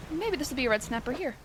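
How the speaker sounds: ordinary talk, nothing marked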